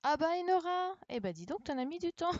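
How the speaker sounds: high, loud mock surprise at a latecomer; ah, finally, you made it; teasing surprise. Real, not acted. Teacher teasing a pupil who arrives late, in high loud mock surprise.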